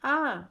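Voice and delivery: teacher modelling a back vowel; back vowel demo. Shown as a demonstration. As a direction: let the आ ring out from the back of the tongue. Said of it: "उसको महसूस करो".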